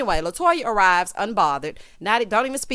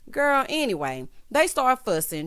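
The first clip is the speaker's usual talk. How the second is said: ordinary delivery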